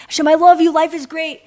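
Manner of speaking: high, bright-spirited call of love; happy declaration. Retold or imitated. Believer to Hashem when life is great, happy declaring. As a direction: declare it gladly, pitched high.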